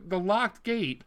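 ordinary delivery

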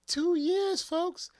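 high, loud, hammering the two years; exasperated shout. Real, not acted. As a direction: rant at the folks in disbelief. Comic rant to audience.

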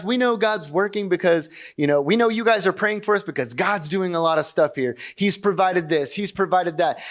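hammed-up cheery video greeting to the congregation; upbeat church video host. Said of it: "And then I'm like".

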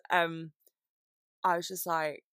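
ordinary delivery

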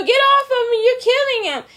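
high-pitched, frantic mock plea; pleading woman. Girlfriend begging the attacker to get off her man, high mock plea. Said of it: "So she's pleading".

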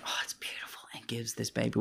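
soft hushed whisper. Retold or imitated. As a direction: breathe it out gently. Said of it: "the nun's like".

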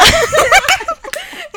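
high loud shriek of laughter. Real, not acted. Laughs after group kiss.

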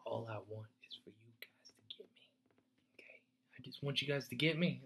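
soft, intimate whisper; breathy heartfelt whisper. Acted. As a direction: lean in and whisper the plea. Pleading with listeners to understand.

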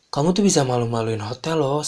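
loud mock reprimand; retold scolding. Manager to employee about embarrassing the hotel, scolding. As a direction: imitate a boss telling off staff.

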